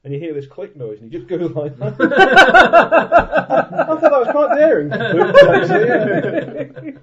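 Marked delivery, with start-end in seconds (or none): 2.0-2.0 laughing while talking; words through laughter. Real, not acted. 2.1-3.8 loud laugh. Real, not acted. 3.9-7.0 laughing while talking; words through laughter. Real, not acted.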